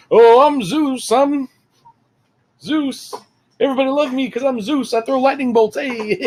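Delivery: high, singsong show-off; Zeus, hammed up. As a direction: ham it up as a self-adoring god. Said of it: "of hamming it up".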